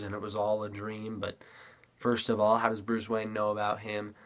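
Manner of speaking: usual speaking voice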